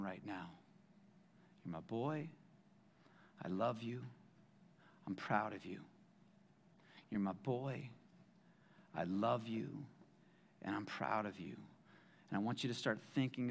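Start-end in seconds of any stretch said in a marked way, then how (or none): 1.6-11.6 tender fatherly reassurance. Acted. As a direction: speak it like a father holding his child. Voicing God's tender words.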